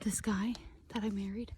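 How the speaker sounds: hushed whisper while hunting; library whisper. Real, not acted. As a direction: whisper so the deer can't hear. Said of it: "why do I have to whisper and be quiet".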